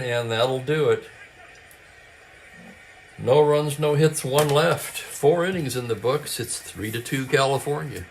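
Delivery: brisk radio ballgame call, lightly lifted; live play-by-play. Acted. Host calling a tabletop baseball game, play-by-play voice. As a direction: call it like a classic radio announcer.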